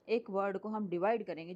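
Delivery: normal talking voice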